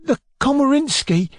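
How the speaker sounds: high, timid, tearful answer; small, high, cowed voice; tearful boy. Acted. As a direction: squeeze it out fearfully. Sobbing boy answers his tormentor.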